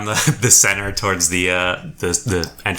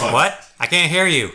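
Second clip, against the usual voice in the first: higher-pitched acted guard; puzzled sentry call; 7 semitones higher than usual. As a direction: call down to someone out of earshot. Guard calls down to party.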